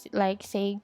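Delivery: ordinary delivery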